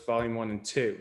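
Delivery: usual speaking voice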